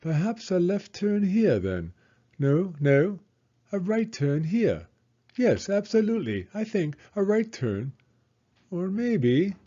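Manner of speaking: high, flustered British guide voice; fussy explorer guide. A narrator's reading. The lost guide dithers aloud.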